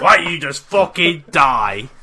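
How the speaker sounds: loud, drawn-out hammed-up insult; mock angry yell. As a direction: join the row with a slow yell. Co-host joins the acted row.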